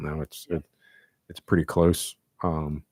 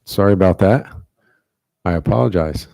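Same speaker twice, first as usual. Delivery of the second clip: louder, projected apology; speaking up; 2 semitones higher, 10 dB louder, faster than usual. Real, not acted. Board speaker apologizing to resident who missed everything, speaking up. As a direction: raise your voice so they can hear. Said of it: "speak a little bit louder".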